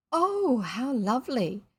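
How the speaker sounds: delighted cry. Acted. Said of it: "cried Jane and Anthea".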